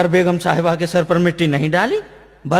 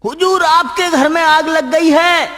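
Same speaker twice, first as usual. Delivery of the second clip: high, slow, drawn-out announcement; sustained high-pitched holler; shouting; 8 semitones higher, 4 dB louder, slower than usual. Acted. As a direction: bawl the news to the master. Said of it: "जोर से नहीं बता सकता था क्या".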